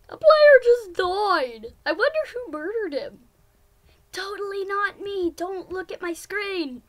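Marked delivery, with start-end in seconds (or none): 0.1-1.7 gleeful announcement. Real, not acted. Repeating the game announcement.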